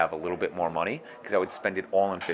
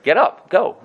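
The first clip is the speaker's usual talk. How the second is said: urging order; 8 semitones higher, 9 dB louder, slower than usual. Retold or imitated. Teacher rousing sleeping student.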